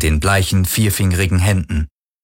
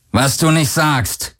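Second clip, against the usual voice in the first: slow, raised-pitch sardonic growl; growled sarcasm; 6 semitones higher, slower than usual. A narrator's reading. Captain answering the pilot's shield report, sarcastic growl. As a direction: drag it out with biting irony. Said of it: "knurrte Akina".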